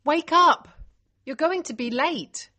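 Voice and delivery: high, loud wake-up call; shouting. A narrator's reading. Liz warns a sleeper she'll be late, urgent shout. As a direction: rouse someone through a closed door. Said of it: "Liz shouts, knocking loudly".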